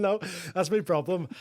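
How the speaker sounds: normal talking voice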